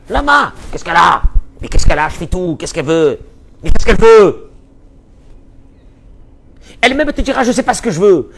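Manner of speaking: hammed-up indignant whine; exasperated husband. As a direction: throw up your hands, the baffled husband. Husband baffled by wife's bitterness.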